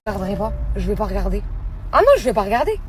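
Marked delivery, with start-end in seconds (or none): 1.9-2.8 loud refusal. Real, not acted. Denial, refusing to look.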